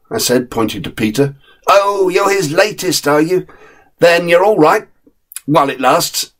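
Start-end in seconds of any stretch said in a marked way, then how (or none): 1.7-6.3 higher, wry terrier voice; a terrier who knows the score; knowing terrier. Acted. Jack warns the new dog, higher and wry.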